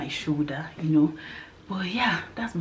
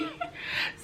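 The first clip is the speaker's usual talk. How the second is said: laugh; 10 dB quieter than usual. Real, not acted. Laughing about her secret.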